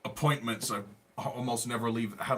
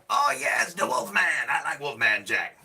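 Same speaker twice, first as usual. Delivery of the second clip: Wolfman Jack; 3 semitones higher, 5 dB louder than usual. Acted. Answering a viewer's comment, hammed-up Wolfman Jack voice. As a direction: belt it out like a wild radio DJ. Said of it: "I sound like Wolfman Jack".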